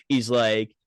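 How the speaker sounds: normal talking voice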